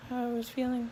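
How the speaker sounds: ordinary delivery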